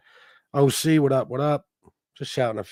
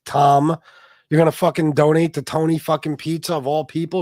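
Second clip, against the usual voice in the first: higher, louder incredulous rant at Tom; exasperated call-out; 5 semitones higher, 3 dB louder than usual. Real, not acted. Streamer calling out a donor who betrayed him, higher and louder disbelief.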